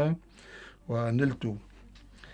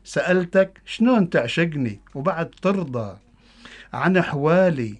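loud, higher Bedouin dialect poem recitation; Bedouin Arabic accent; 4 semitones higher, 7 dB louder than usual. Acted. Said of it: "قصيدة باللهجة البدوية".

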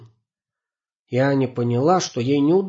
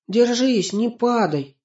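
calling out; 10 semitones higher, slower than usual. Acted. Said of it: "она крикнула Сипсику".

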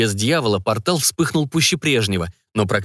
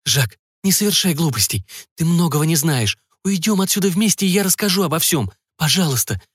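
much higher, desperate begging; pleading; 6 semitones higher than usual. Acted. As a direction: beg him through near tears. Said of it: "взмолилась колдунья".

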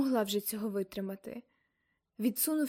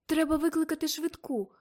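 higher, hurried urgent plea; 5 semitones higher, 4 dB louder than usual. Acted. Girl urging an ambulance, higher and urgent. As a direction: press them to act now.

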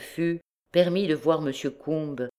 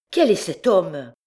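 high, louder alarmed question; alarmed cry; 4 semitones higher, 5 dB louder than usual. A narrator's reading. Woken man asks Miette about the intruder, alarmed cry. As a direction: demand it in a fright. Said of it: "s'écria-t-il".

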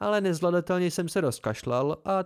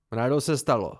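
offended dignity. Acted. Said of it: "s uraženou důstojností".

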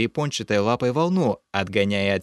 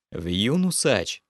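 ordinary delivery, nothing marked

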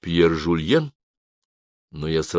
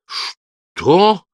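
high, loud burst of disbelief; sharply rising astonished question; shocked exclamation; 11 semitones higher, 7 dB louder than usual. A narrator's reading. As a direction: cry out in shock. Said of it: "воскликнул Пьер Жульен".

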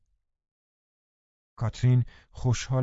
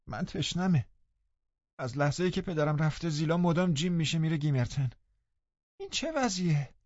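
high, soft, sullen whining; sulky sickly boy; 7 semitones higher, 4 dB quieter than usual. A narrator's reading. Linton sulking to the housekeeper, high and whiny. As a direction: grumble like a spoiled invalid. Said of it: "با اخم و تخم".

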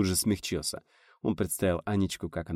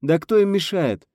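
high, loud, drawn-out retort; exclamation; 10 semitones higher, 7 dB louder than usual. A narrator's reading. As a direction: cry it out indignantly. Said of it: "воскликнул селенолог". (громкое восклицание)

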